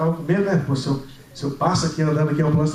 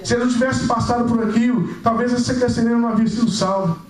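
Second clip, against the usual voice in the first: fervent preaching; 5 semitones higher than usual. Real, not acted. Preacher to the congregation on God saving lives, fervent preaching.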